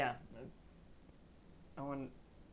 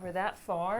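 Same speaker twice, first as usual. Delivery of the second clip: ordinary talk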